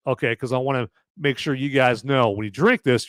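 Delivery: ordinary talk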